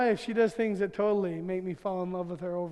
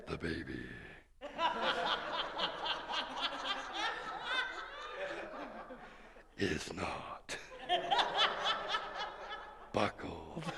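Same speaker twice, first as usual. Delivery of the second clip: demonic voice; 7 semitones higher, 12 dB quieter, slower than usual. Retold or imitated. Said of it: "but her voice changed".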